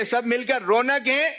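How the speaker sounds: high, rising call to the crowd; rallying call. Real, not acted. Host gets the whole audience to join in, rallying call. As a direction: lift the last word for the crowd to answer.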